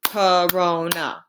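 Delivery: slow "Corona", each syllable apart; syllables counted out. Shown as a demonstration. Teacher counts the syllables in "Corona", slowly. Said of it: "That's three syllables".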